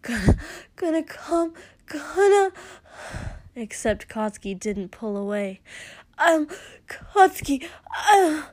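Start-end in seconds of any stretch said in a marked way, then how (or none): 0.0-2.5 high, loud gasped words; breathless rising cry; gasping. Acted. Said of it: "he was gasping". 6.2-8.4 high, loud broken cry; anguished outcry. Acted. Crying out in intimate scene.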